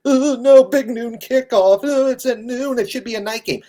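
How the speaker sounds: high, loud whining mockery of fans; sarcastic high complaint imitation; mock whiny fan. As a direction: whine it like a crybaby fan. Mocking whiny fans' complaint.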